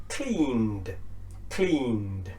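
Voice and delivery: "cleaned" slowly, long vowel and N into d; D ending. Shown as a demonstration. Teacher modelling the N ending, "cleaned" as slow cleend. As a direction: stretch "cleaned" as one syllable, cleeend. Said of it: "for an N ending".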